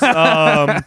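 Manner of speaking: ordinary delivery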